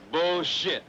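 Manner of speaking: emphatic repeat. Acted. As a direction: punch the second Bullshit up and loud. Haggling, repeats bullshit.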